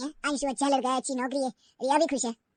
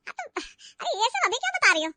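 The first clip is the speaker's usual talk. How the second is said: high, loud flustered protest; mother-in-law embarrassed in front of her friends; 9 semitones higher, 6 dB louder than usual. Acted. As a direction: cry out in shame before friends. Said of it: "माँ को शर्म आई".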